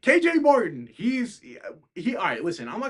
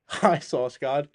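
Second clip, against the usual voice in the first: slow greeting, rising end; 5 dB louder, slower than usual. Real, not acted. Greeting a viewer who joined.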